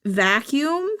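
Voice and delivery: normal talking voice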